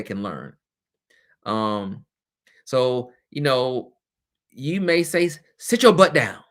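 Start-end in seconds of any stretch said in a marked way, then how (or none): 5.3-6.4 mock teacher snap, higher and louder; snapping at a student. Teacher scolding a disruptive child, mock louder snap. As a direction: bark it at a kid who won't sit. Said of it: "you really shouldn't say".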